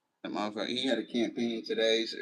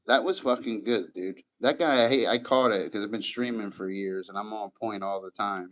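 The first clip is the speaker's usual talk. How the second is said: ordinary delivery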